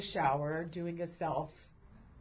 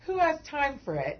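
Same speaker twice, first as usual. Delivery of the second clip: words bubbling with laughter, pitched up; giggly rhetorical question; laughing; 7 semitones higher, 4 dB louder than usual. Real, not acted. Laughing while talking.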